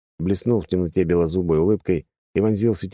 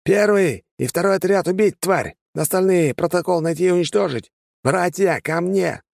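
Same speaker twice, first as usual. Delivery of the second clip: shouting orders; 12 semitones higher than usual. A narrator's reading. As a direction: bark orders over the fight. Commander shouting orders in battle.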